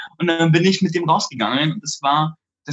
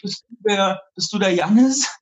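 timid, raised, halting question; nervous greeting; 5 semitones higher than usual. Retold or imitated. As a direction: ask shyly, unsure you've got the right guy. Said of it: "ganz nervös hin und sag so".

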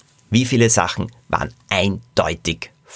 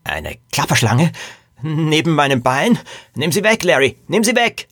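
startled, climbing ever higher in pitch; frightened; 6 semitones higher than usual. Acted. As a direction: panic building to a high-pitched squeal. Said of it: "Herr Scharf zuckte erschrocken zusammen".

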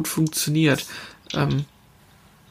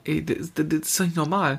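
normal talking voice

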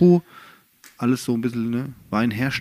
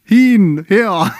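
East German dialect; 3 semitones higher, 7 dB louder, slower than usual. Retold or imitated. Quoting a comedy group to the co-host, shouted East German dialect. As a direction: mimic an East German comedy bit at full volume. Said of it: "mit dem ostdeutschen Dialekt".

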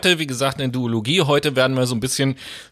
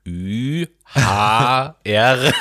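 slow spelling; much slower than usual. Pretend. Spelling the word out slowly.

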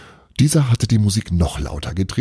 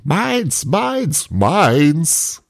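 put-on high-pitched boyish cries; boy shouting; 10 semitones higher, much slower than usual. A narrator's reading. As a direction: cry it out high, word by word. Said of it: "während der Junge schrie".

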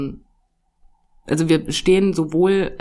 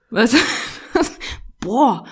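ordinary talk, nothing marked

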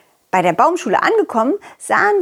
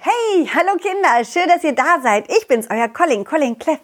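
silly clown; 6 semitones higher than usual. Acted. Kids' host greeting.

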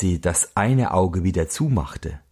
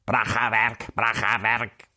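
duck's cry; 2 semitones higher than usual. Acted. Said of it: "mit dem Ausruf".

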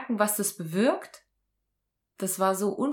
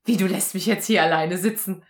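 louder stunned what, then disbelieving question; shocked disbelief; 2 semitones higher, 9 dB louder than usual. Retold or imitated. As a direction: react like you can't believe it. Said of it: "Und ich so".

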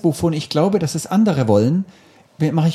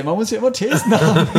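chuckling through the words; laughing. Real, not acted. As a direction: laugh while saying it. Wry remark about theses.

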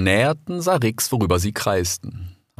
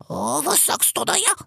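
much higher, rasping vulture voice; hoarse vulture; 4 dB quieter than usual. A narrator's reading. Vulture Bakit questioning the fox, hoarse. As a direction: croak it out like an old vulture.

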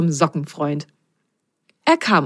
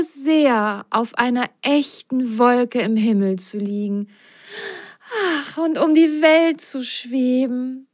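slow, high wistful sigh; sighing, dreamy; 7 semitones higher, slower than usual. Acted. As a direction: dream aloud longingly. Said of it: "Biene seufzte". (seufzend, verträumt)

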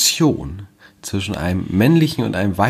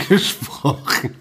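laughing; 6 semitones higher, much slower than usual. Real, not acted. Laughing while wrapping up episode.

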